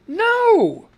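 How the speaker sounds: sudden high shout of dismay; a cry of disbelief, way up high; shocked protest at a paywall; outraged no. Real, not acted. Reacts to BBC paywall.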